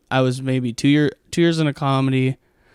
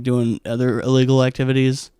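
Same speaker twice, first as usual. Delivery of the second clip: ordinary talk, nothing marked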